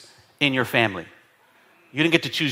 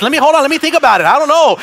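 acted haggling; 8 semitones higher, 4 dB louder, much faster than usual. Acting out a car-lot haggle.

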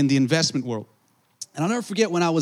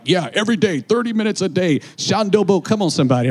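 a hint of a hyped preacher, louder; mock preacher; 3 semitones higher, 3 dB louder than usual. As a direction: ham up a churchy show-off answer. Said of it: "I'm not like".

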